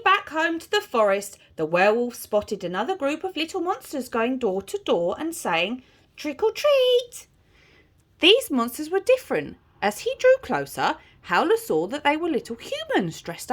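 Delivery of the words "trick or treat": put-on high, sing-out kids' chant; children calling out. A narrator's reading. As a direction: call it at the door like trick-or-treaters.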